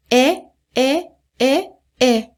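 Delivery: é as a bare open vowel, no S or T; silent final consonants. Shown as a demonstration. Teacher drilling French verb forms, silent endings on é. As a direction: repeat a held, separate é each time. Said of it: "Não pronuncia o S".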